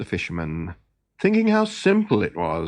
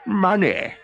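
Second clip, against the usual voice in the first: eager inventor; 8 semitones higher than usual. Acted. Olaf naming the coins.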